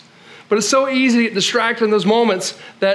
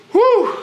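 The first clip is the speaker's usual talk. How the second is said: heavy whew; 4 dB louder than usual. Acted. Emotional heaviness.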